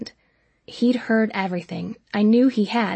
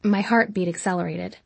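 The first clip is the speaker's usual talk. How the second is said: plain reading voice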